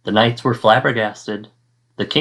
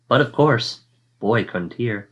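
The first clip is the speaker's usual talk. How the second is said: ordinary delivery, nothing marked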